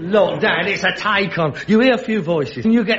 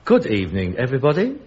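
low, halting ghostly host; Henry the eighth; 5 semitones lower than usual. Acted. Son mocks the seance voice, low spirit host. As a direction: intone a mock spirit greeting, low and deliberate. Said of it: "And this voice says".

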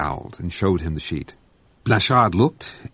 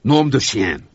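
French exclamation; 5 semitones higher, 5 dB louder, slower than usual. Acted. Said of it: "ejaculated Blanchard".

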